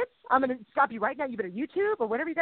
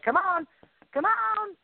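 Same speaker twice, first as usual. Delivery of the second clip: God nudging; 8 semitones higher, much slower than usual. Retold or imitated. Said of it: "He's like".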